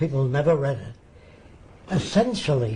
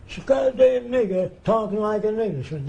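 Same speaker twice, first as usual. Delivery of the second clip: higher-pitched mock outburst, quoting a bigot; Lou's reaction; 6 semitones higher than usual. Man quoting Lou's reaction to the film, higher-pitched mock outburst. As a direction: imitate an indignant reaction in a raised pitch. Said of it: "This is Lou's reaction".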